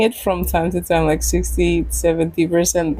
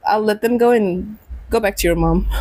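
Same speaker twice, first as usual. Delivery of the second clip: usual speaking voice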